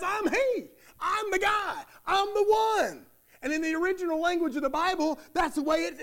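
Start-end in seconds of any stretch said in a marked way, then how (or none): 0.0-3.1 high, eager, insisting he's the one; a healed beggar proudly claiming it's him; excited insistence. Retold or imitated. Healed beggar insisting to neighbors, high and excited. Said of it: "Can you hear the excitement in his voice?".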